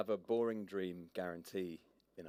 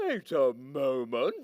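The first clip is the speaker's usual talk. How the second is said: louder, slow stern llama; 4 semitones higher, 8 dB louder, slower than usual. Acted. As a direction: halt her like a suspicious clerk. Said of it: "Said the llama".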